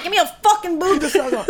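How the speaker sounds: put-on high crying baby whine; hungry baby. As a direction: wail for milk like a baby. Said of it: "This is a hungry baby".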